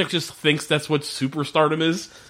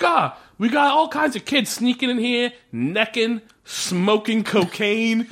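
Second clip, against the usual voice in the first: building super; 7 semitones higher, slower than usual. Acted. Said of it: "The guy's like".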